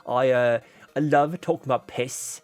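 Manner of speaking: loud, hammed-up British cat boy voice; over-the-top British accent, shouted and playful; Ziri impression. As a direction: play a cheeky British lad, bellowing. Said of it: "I'm gonna do a Ziri impression".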